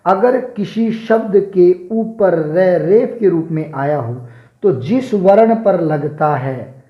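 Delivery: ordinary talk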